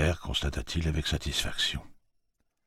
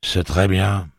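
flat but emphatic; 5 semitones higher, 7 dB louder, slower than usual. A narrator's reading. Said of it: "d'une voix atone et pourtant étrangement emphatique".

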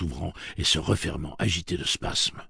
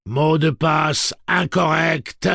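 loud, slow, high verdict; drawn-out, exultant robotic refusal; triumphant robot; 11 semitones higher, 9 dB louder, much slower than usual. A narrator's reading. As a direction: announce the failure in machine triumph. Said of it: "C'était à présent du triomphe".

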